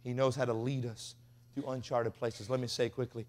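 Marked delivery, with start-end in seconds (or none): none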